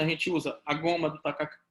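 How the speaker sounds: ordinary delivery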